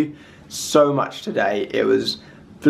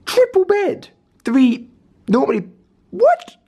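much higher, louder baffled outburst; surprised; 3 dB louder than usual. Real, not acted. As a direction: squeak of disbelief at the bed. Finds three-pillow bed.

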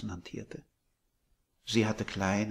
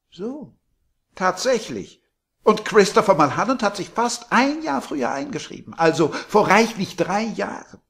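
put-on high, much louder gushing old landlady; sweet landlady; 11 semitones higher, 15 dB louder than usual. A narrator's reading. Landlady surprising Billy from behind, high and gushing. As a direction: coo it like a doting hostess.

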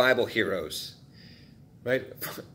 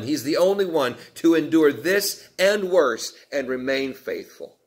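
ordinary delivery

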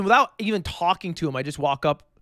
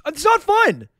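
loud, high-pitched protest; indignant shout; 10 semitones higher, 6 dB louder than usual. Real, not acted. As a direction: shout back in indignant objection. Protesting the teasing remark.

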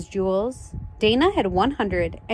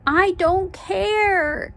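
long, drawn-out high wail of protest; exasperated high-pitched cry; 9 semitones higher, much slower than usual. Acted. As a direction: cry it out slowly, stretching each word. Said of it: "cried Dana".

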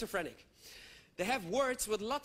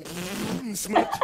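1 thud noise; 7 dB louder than usual. Pretend. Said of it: "like you drive over something".

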